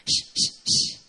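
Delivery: put-on soothing baby shush. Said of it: "sometimes I shush her".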